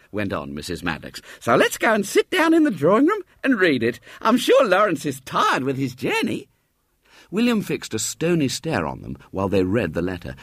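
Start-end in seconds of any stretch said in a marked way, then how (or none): 1.4-6.5 put-on much higher sweet old lady voice; motherly lady. Mrs Maddox greeting the boy at the door, high and motherly.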